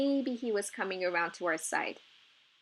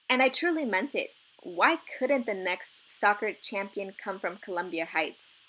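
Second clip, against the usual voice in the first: usual speaking voice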